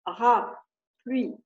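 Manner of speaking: very slow, each syllable pulled apart; slow teacher's syllable count. Shown as a demonstration. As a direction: stretch parapluie out as pa-ra-pluie, one beat per syllable. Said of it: "il va mettre un trait pour chaque syllabe".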